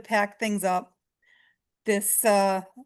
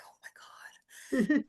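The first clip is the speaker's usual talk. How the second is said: a hushed, breathless oh my god; awed whisper; 5 semitones higher, 4 dB quieter, much slower than usual. Retold or imitated. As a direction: whisper it in disbelief, slow. Stunned reaction retold.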